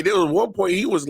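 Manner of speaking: ordinary talk, nothing marked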